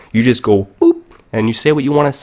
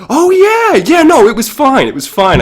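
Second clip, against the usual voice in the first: much higher, louder, then settling down; flustered cover-up; 9 dB louder than usual. Acted. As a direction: blurt it out high and loud, then calm it down. Caught off guard by the friend.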